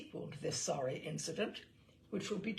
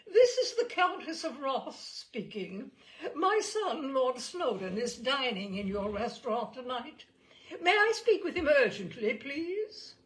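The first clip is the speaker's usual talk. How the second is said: high, loud fluting society lady; a posh older lady trilling with a laugh; fluting upper-class Englishwoman; 5 semitones higher, 6 dB louder than usual. Retold or imitated. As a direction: put on a grand countess voice down the phone. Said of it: "I assumed the fluting tones of a middle-aged, upper-class Englishwoman".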